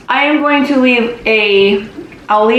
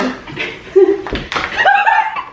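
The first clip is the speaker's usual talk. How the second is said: high giggle. Real, not acted. Melted candy surprise.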